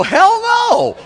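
loud, high mock retort, laughing; joking shout. Pastor pretends to snap back at the skier, a laughing mock shout. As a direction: blurt it out as a pretend comeback, laughing.

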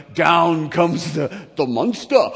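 loud, drawn-out movie-monster buildup; melodramatic horror. Acted. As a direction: announce it like an old horror film. Dramatizes a monster movie scene.

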